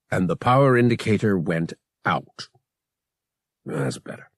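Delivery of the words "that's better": low, soft, grim muttering. A narrator's reading. Han after blasting the console, muttering grimly. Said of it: "Han muttered grimly".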